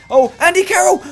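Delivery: high, excited yelp at the chance; excited cry. Real, not acted. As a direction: shout his name in real thrill. Reacting to a near goal.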